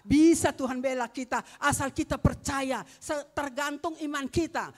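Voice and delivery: loud, ringing sermon proclamation; full-force preaching. Acted. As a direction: proclaim it to the whole congregation. (berkhotbah dengan lantang)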